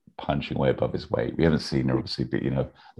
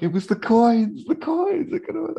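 mock panicked excuse; 4 dB louder than usual. Voices Larry's panicked excuse.

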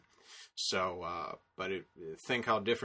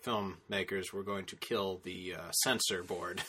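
ordinary talk, nothing marked

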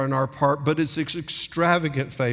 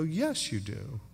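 imitated child's answer; 3 semitones lower, 8 dB quieter than usual. Child answering Daddy's bedtime question, softly certain. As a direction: imitate a little one quietly reassuring Daddy.

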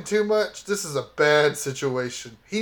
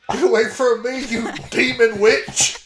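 shouting; 5 semitones higher, 5 dB louder than usual. Acted. Player shouts at attacker.

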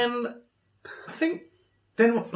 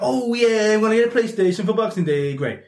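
higher, louder burst of retold glee; excited; 6 semitones higher, 4 dB louder than usual. Retelling his reaction to the shop assistant, excited. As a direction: act out eager delight at a lucky find. Acting out his delight at hearing stock exists.

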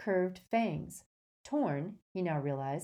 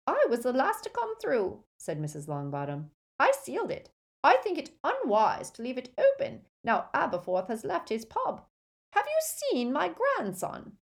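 put-on high, brisk elderly lady; a proud grandmother in command; crisp old witch; 7 semitones higher, 4 dB louder than usual. Mrs Longbottom speaks.

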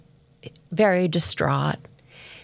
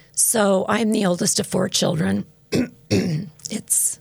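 ordinary talk, nothing marked

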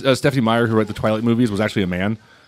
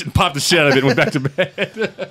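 normal talking voice

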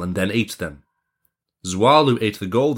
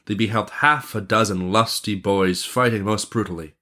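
ordinary delivery

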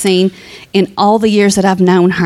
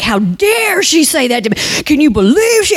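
indignant quote; 6 semitones higher than usual. Retold or imitated. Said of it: "And she was like".

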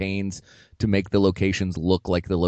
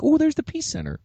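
high excited tourist; 3 dB louder than usual. Retold or imitated. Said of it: "you're like".

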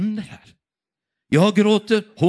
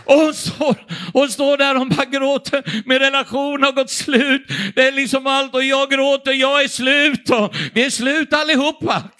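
loud, high impassioned preaching; full-force preaching; 8 semitones higher, 6 dB louder than usual. Acted. Preacher to congregation, crying out passionately. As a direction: preach it out with rising fervour.

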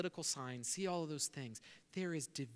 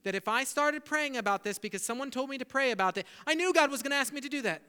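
a frustrated believer blowing up at God; building from a grumble to a loud, fast rant; exasperated outburst; 6 semitones higher, 7 dB louder, faster than usual. Retold or imitated. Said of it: "with our exasperation are we like".

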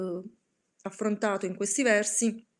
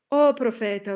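louder, anguished outcry; shouted cry; 7 dB louder than usual. Acted. Narrator screams at the raven, louder and anguished. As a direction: cry out to the bird in despair.

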